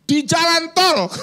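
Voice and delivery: mock-indignant stressed repeat; emphatic exclamation. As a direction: land "di jalan tol" big and slow like a punchline. Climax of retold protest.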